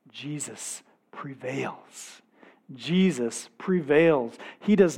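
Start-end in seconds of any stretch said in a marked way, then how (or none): 0.1-2.2 slow, near-whispered awe; hushed. Shown as a demonstration. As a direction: say it softly, almost to yourself. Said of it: "repeated louder after".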